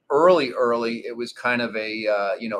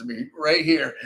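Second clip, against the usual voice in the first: laughing; 5 semitones higher, slower than usual. Real, not acted. Laughing while retelling the story.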